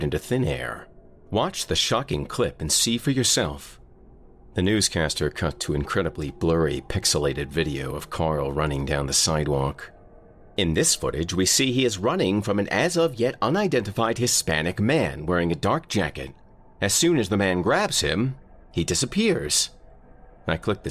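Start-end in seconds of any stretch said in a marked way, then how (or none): 1.3-3.8 lightly voiced TV news anchor, a bit higher; a hint of a news anchor; newscaster. Voicing a TV newscaster. 10.6-19.7 lightly voiced newscaster, rising higher; a hint of a news read; news report. Newscaster describing the footage.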